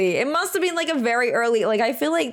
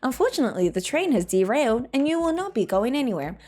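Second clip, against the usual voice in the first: polite, measured public-address announcement; voice-acting a courteous train announcer with cheeky sign-off; evenly enunciated mock transit announcement. Shown as a demonstration. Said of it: "So I could do voice acting. I could be like".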